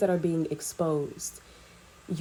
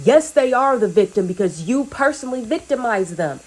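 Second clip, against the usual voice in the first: higher and louder, driving the questions home; fiery preaching; 4 semitones higher, 5 dB louder than usual. Acted. Speaker confronting the enemies, fiery preaching. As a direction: preach it at full force, demanding answers.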